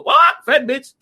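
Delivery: high squawk, then the echoed insult; imitated talking bird. Bird echoes Red Fox's insult, squawking imitation. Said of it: "and the bird go".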